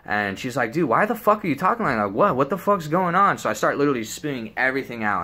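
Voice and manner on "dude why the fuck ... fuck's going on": imitated high-pitched disbelief; mock shocked questioning; alarmed friend. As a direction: demand an explanation, pitch raised. Voices friend alarmed by his words.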